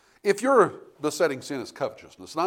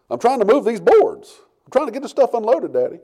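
put-on frustrated child; 10 semitones higher, 14 dB louder than usual. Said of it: "he's getting angry".

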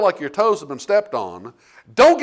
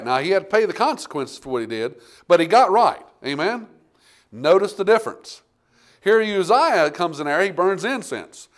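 ordinary delivery

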